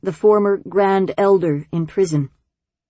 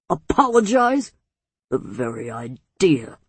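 indignant huff; 6 semitones lower than usual. A narrator's reading. Elder scoffing at being told to apologize, huffy. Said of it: "the former Grand Elder huffed".

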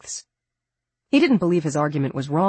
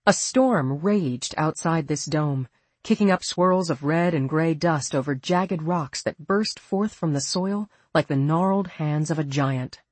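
ordinary delivery, nothing marked

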